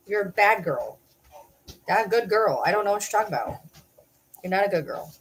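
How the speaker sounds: ordinary talk, nothing marked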